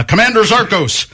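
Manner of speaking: high-pitched mock villain title; old sci-fi villain. Host giving guest a mock title, playful sci-fi villain. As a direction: announce him as a space-movie commander. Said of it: "like a villain of an old science science fiction".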